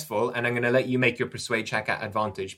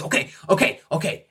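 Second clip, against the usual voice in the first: higher, breathy, hurried surrender; nervous giving in; 6 semitones higher than usual. Acted. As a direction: placate her quickly, scared. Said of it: "he starts getting very nervous indeed".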